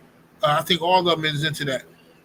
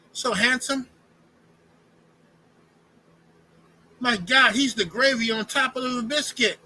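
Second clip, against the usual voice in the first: higher, breathless gushing praise; swooning admirer; 6 semitones higher than usual. Retold or imitated. Mocking a man gushing over a fighter, high swooning. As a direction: drool over him like a lovestruck fan. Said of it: "say that and say".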